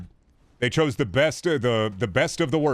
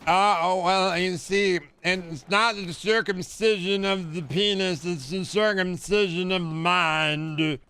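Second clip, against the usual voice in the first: higher, slow put-on voice; mocking Brainworm; 5 semitones higher, slower than usual. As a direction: drawl it out like a smug guru. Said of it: "Mister Brainworm himself said".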